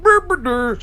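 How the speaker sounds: buzzing drone noise, lips vibrating. Retold or imitated. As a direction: imitate a whirring drone.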